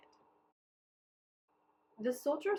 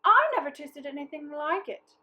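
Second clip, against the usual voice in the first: louder, higher amazed praise; delighted wife; 6 semitones higher, 13 dB louder than usual. A narrator's reading. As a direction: gush over the taste. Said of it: "said his wife".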